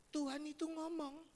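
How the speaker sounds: usual speaking voice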